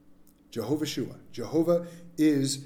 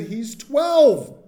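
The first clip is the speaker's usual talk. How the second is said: louder, pitched-up retort; retold retort; 8 semitones higher, 8 dB louder than usual. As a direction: punch it like a fed-up youth pastor. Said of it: "And I looked at her and I said".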